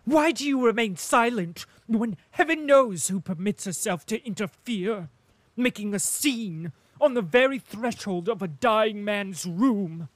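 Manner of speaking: high, loud furious scolding; loud angry tirade. A narrator's reading. Princess rails at Prince Vasily, loud and high. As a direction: berate him at full voice. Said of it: "so loud that those in the drawing room heard her".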